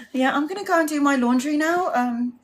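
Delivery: high, awkward exit from the chat; awkward posh exit. Retold or imitated. Colleague Beryl edging away from the overshare, high and awkward. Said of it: "she would be like".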